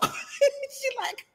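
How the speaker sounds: giggling, high and soft; amused at herself, softly; laughing. Real, not acted. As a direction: laugh through the retelling. Laughing at her own mistake.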